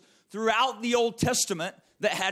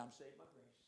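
"I'm saved by grace" tossed off much softer and lower; mock careless throwaway; imitating people brushing it off, barely voiced; dismissive mumble; 11 semitones lower, 33 dB quieter, faster than usual. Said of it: "people just want to say".

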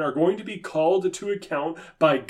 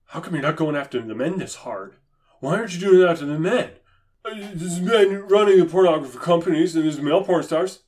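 mocking critic; 3 semitones higher, faster than usual. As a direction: rattle it off like a whiny objector. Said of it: "somebody's going to say".

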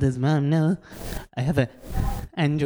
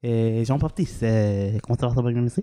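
low, fake French chatter; French accent; 4 semitones lower, slower than usual. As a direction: lay on a cartoon French accent. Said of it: "in that French accent".

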